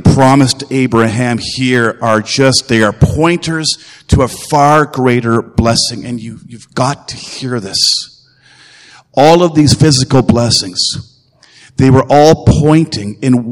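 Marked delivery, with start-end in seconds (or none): 6.0-7.8 a hushed, pressing aside; quiet urgent appeal. Real, not acted. Preacher pulling his congregation close, hushed and urgent. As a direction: drop the voice and lean in close.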